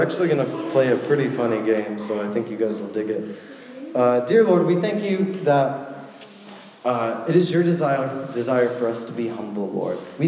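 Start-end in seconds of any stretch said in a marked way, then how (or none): none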